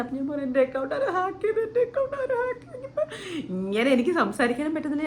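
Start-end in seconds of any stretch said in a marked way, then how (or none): 0.5-3.0 high wailing sob; crying to mum. Retold or imitated. Crying to mum that her account got hacked, high wailing sob. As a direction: wail it out like a crying child. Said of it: "പോയി ഒരു ഒറ്റ കരച്ചിൽ".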